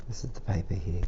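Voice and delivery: plain reading voice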